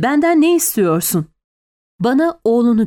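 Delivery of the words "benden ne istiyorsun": mildly angry, pitch raised; irritated. A narrator's reading. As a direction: demand it with a touch of annoyance. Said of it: "dedim sinirle".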